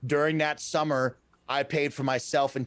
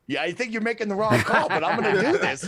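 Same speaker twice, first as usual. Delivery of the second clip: laughing quote; 6 semitones higher, 4 dB louder than usual. Retold or imitated. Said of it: "And I was like".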